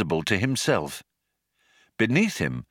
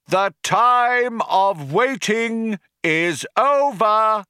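high, loud, slow orator proclaiming; rally the crowd over a tannoy; crying out to crowd; 10 semitones higher, 3 dB louder than usual. A narrator's reading. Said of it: "he cried out".

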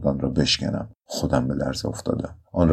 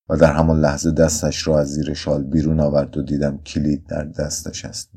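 usual speaking voice

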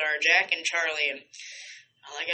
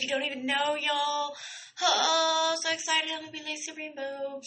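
excited squeal; 8 semitones higher, 4 dB quieter than usual. Real, not acted. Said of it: "I'm so excited".

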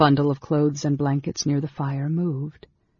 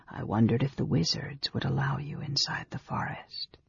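breath-only whisper, much softer; 8 dB quieter than usual. A narrator's reading. Ailing mage to Amberol by the fire, whispered. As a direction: whisper it weakly. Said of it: "he whispered".

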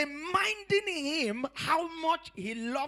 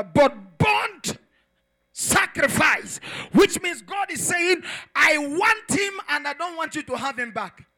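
loud emphatic preaching; preaching at full force; 2 semitones higher, 9 dB louder than usual. Acted. Preacher thundering to the congregation about burnt offering. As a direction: drive home burnt sacrifice.

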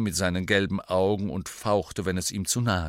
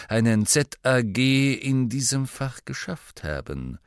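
slow, drawn-out lecturing with loud stressed openings; condescending schoolmaster; 4 dB louder than usual. Acted. Snape lectures his class on exams, condescending. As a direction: stretch words disdainfully, sinking at the end.

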